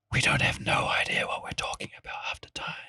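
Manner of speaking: hushed confession aside; whispering. Real, not acted. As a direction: whisper it like a secret.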